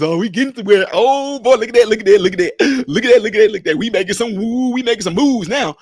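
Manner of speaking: mock excitement. Mocking hyped-up folks gasping over good news. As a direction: play the hyped believer who thinks the money is coming. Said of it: "this stuff that folks".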